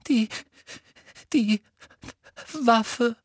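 a distressed schoolgirl; Hermine voice. A narrator's reading. (Hermine mit hoher, verzweifelter Stimme)

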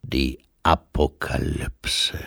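low, whispered Death; slow, hollow whisper of Death; Death's voice. A narrator's reading. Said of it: "sagte Tod". (Tod, leise geflüstert)

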